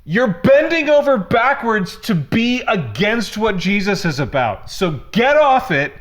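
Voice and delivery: climbing onto a soapbox and not getting off; ranting. Real, not acted. Pastor venting at Christians who miss the point, worked-up rant. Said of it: "All right, I'm off it".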